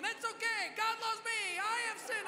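high, loud mock child shouting; eager little boy. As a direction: pipe up insistently like a five-year-old. Eager son butts in from behind.

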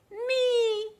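excited high cry of me; joyful reveal. Acted. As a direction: shout the answer with delight. Punchline answer to the question.

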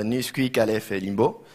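ordinary talk, nothing marked

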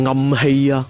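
ordinary delivery, nothing marked

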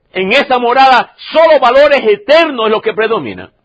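loud, high impassioned sermon; fervent preaching. Acted. Pastor preaching hard to the congregation, fervent and loud. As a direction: preach it out with rising fervor.

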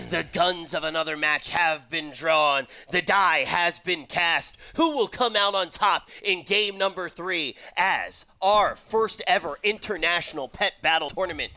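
projected, theatrical esports introduction; match announcer. Acted. Commentator introduces game three of the tournament, match announcer. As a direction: herald the next game with grand, punched phrases.

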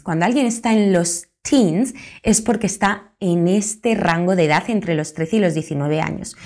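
usual speaking voice

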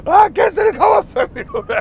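dad panicking, the guests are almost here; stressed dad. Retold or imitated. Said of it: "far, han var sådan helt". (far råber stresset og panisk)

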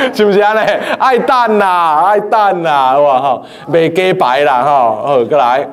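normal talking voice

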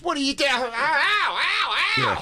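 high, strained yelps of pain, hammed up; imitating someone yelping in pain; mock pained yelping. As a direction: play a guy yelping as he gets hit. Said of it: "He goes".

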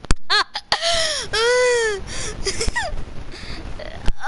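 high cry-like laugh; wheezing laugh. Real, not acted. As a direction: laugh till it squeaks. Keeps laughing.

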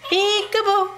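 very high pet-talk squeal; high singsong game voice; playful peekaboo. Real, not acted. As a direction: sing it out high to a bird. Playing with pet bird.

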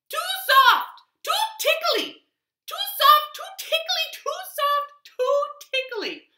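very high, loud squeaky complaint; little creature voice. Acted. The hudgeon complains about its bed, very high and loud. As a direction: squeak it like a tiny fussy creature. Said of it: "he heard a voice say".

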